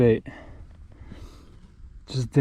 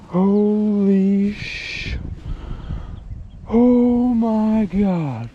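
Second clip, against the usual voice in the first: higher, slow, drawn-out awe; awestruck; 6 semitones higher, much slower than usual. Real, not acted. Angler staring at the fish, slow awestruck cry. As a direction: stretch every word in disbelief.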